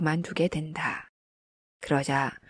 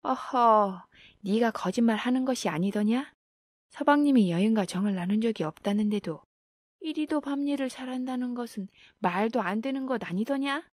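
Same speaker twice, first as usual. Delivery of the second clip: higher-pitched indignant scolding, no real shout; angry yelling; 6 semitones higher than usual. A narrator's reading. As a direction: scold a lying servant in a raised pitch. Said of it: "고래고래 소리를 질러댔다".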